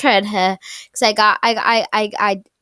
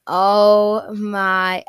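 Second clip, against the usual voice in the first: ordinary talk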